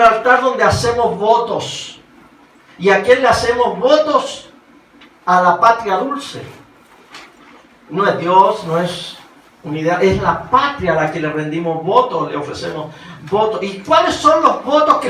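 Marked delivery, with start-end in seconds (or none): none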